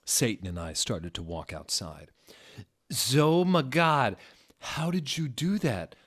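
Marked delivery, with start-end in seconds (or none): 2.9-5.9 high, loud, breathless gush; hammed-up lusty awe; turned-on. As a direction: swoon over what she just did. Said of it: "asked in a turned-on voice".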